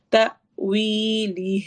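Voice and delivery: تأویلہ slow, a jolt on the hamza, وی stretched. Shown as a demonstration. Teacher showing the class the hamza with sukoon. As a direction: recite تأویلہ with a catch at the hamza. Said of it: "وی کو لمبا کریں گے دو حرکہ".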